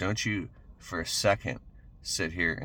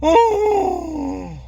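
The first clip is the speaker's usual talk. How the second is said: huge high yawn, voiced loud; big yawn; 13 dB louder than usual. Real, not acted. Speaker yawning mid-talk to viewers, loud yawn. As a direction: let a real yawn break out over the word. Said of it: "excuse me, the yawn is".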